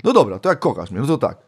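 normal talking voice

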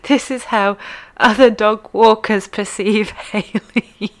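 real giggles breaking into the words; reading through laughter. Real, not acted. Host reading a listener's joke about herself, laughing. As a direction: struggle to read it out, laughing. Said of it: "I'm laughing looking at this".

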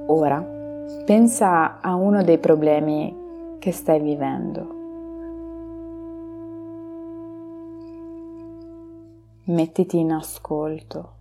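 slow, soft meditation voice; guided meditation. Acted. Leads a relaxation meditation.

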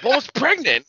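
imitated incredulous comeback; acted exasperation. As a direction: state the obvious, acting exasperated. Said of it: "He's like".

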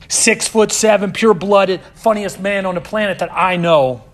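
booming proud build-up; loud praise. Real, not acted. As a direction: shout his friend's praises. Preacher hyping his friend.

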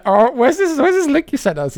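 high, puzzled searching; sudden confused squeak; surprised. Real, not acted. Suddenly hunting for a link.

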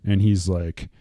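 ordinary talk

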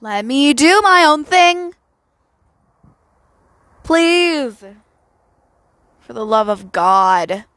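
loud, pleading mock outburst at dad; exasperated outburst. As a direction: snap back loudly, fed up and begging. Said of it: "I'm gonna be like".

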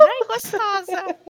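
bem mais agudo, lento e infantil; como o Tinky Winky. Retold or imitated. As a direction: fale como um boneco infantil dando cantada. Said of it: "tipo Tinky Wink falando". (como o Tinky Winky, agudo e lento)

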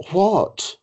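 high, incredulous mock outburst; imitating a stunned, high-pitched reaction. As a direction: retell a host's affronted "what?", pitched right up. Host's indignant reply, retold.

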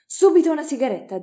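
shout. A narrator's reading. Professor shouts to the maid for a cigarette, a loud call. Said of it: "e gridò".

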